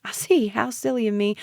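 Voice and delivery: mock yourself to belittle the listener; sarcastic, lifted voice; laughed. A narrator's reading. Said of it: "he laughed".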